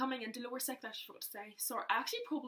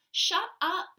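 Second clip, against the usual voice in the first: loud, higher mock snap; snapping sister; 9 semitones higher, 13 dB louder than usual. Sister snapping at Hannah, loud. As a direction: cut her off sharply.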